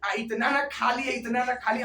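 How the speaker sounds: ordinary talk, nothing marked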